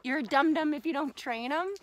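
ordinary delivery

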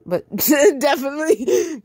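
laughing at own slip; breaking up mid-word; laughing stumble. Real, not acted. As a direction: giggle over a misread. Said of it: "But she definitely puts it".

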